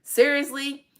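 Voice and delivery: high, incredulous Seriously; disbelieving. Retold or imitated. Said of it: "I'm like".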